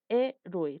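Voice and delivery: "Héroe" broken after a stressed HÉ; separated syllables. Shown as a demonstration. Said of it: "también tres explosiones de voz".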